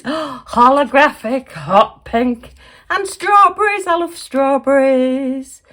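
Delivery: drawn-out admiring gush; gasping delight. Real, not acted. Admiring gifts from Zoe, slow delighted gush. Gasps at strawberry stickers.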